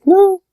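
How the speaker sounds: a sharp, high-pitched NO; emphatic no. Real, not acted. To viewers who think 2001 is a space film, an emphatic high no. Rejecting the audience's assumption.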